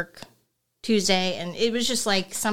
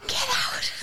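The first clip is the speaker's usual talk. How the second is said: high unvoiced whisper, urgent but hushed; whispered warning. Joining the whispered fire bit.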